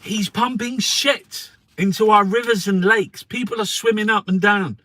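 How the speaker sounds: loud, high furious rant; angry ranting. Real, not acted. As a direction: shout it out in real outrage. Furious rant about polluted rivers.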